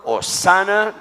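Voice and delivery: Hosana cried out high and loud; acclaimed Hosana. Acted. Preacher voicing crowd, loud acclaimed Hosana. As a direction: shout Hosana as a joyful cheer. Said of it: "aclamaba diciendo".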